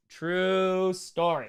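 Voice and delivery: very slow, stretched TRUE; drawn-out repeat. Real, not acted.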